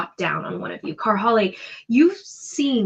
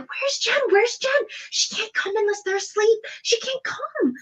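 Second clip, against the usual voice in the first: very high, anxious pixie chatter; frantic pixies. Acted. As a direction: squeal like a panicked little sprite. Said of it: "They're like".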